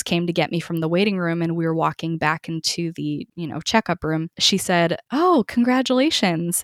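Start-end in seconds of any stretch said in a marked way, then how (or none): 5.1-6.6 imitating a bright receptionist, pitched higher and louder; mock-bright receptionist voice; chirpy congratulations. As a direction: mimic the cheerful front-desk greeting. Nurse greets her again.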